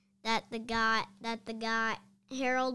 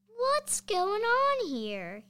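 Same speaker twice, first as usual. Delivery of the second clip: slow, much higher gruff demand; demanding principal; 10 semitones higher, 4 dB louder, much slower than usual. Acted. Mister Krupp wakes up dazed and demands answers. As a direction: drag the question out like a woken bully. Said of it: "he demanded".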